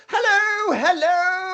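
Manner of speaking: very high, long-drawn call; distant hobbled old man. Acted. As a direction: call out across the harbor in a high cracked-old-man act. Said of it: "you hear this voice from across the harbor".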